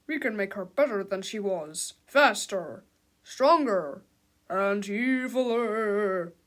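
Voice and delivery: emphatic, building list; mad-doctor proclamation; doctor character. A narrator's reading. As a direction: draw out the last word menacingly. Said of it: "said the doctor".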